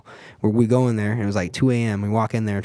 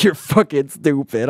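mocking retort; 4 semitones higher, 5 dB louder, slower than usual. Retelling snapping at ride staff.

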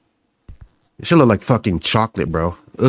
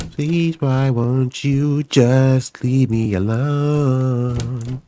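slow pleading; 3 semitones higher, much slower than usual. Pretend. Slow plea to monster.

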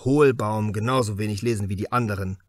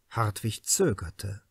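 usual speaking voice

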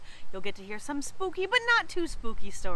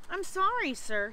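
mild, higher-pitched sighing apology; 7 semitones higher than usual. A narrator's reading. Young ghost apologising to the principal, mild sigh. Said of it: "he sighed".

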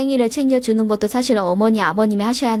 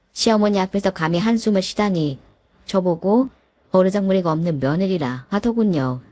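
ordinary delivery

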